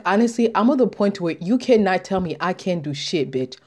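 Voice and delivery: usual speaking voice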